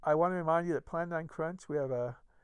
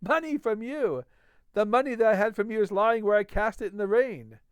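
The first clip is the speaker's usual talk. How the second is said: louder, higher bitter scorn; scornful Fetz; 6 semitones higher, 6 dB louder than usual. A narrator's reading. Fetz rejecting the doctor's money, loud bitter scorn. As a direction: throw the offer back at him.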